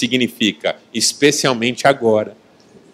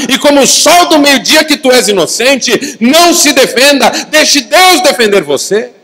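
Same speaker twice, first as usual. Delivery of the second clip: high, loud, rousing proclamation; fervent sermon climax at full voice; full-force preaching; 8 semitones higher, 7 dB louder than usual. Real, not acted. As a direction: drive each phrase home to the congregation.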